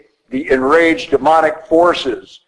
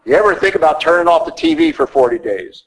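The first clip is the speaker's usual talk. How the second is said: ordinary talk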